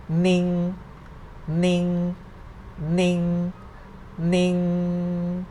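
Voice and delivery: "ning" held level, drawn out; mid tone. Shown as a demonstration. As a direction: sustain each "ning" on one even pitch. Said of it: "no tone mark represent the mid tone".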